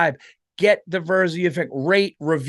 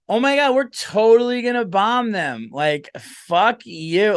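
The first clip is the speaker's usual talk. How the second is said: higher, brash mock party-girl voice; drunk woman in a bar; 6 semitones higher, 3 dB louder than usual. Female president threatening to bomb them, mock drunk bar girl. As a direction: play a tipsy woman, dragging out the curse. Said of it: "just a drunk chick in the bar".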